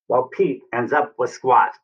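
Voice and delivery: usual speaking voice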